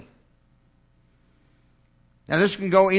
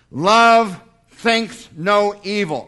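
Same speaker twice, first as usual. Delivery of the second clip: slow, louder proclaiming of scripture; slow preaching proclamation; 3 semitones higher, 6 dB louder, much slower than usual. Acted. Preacher to congregation, slow proclaiming scripture. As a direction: draw each word out for the congregation.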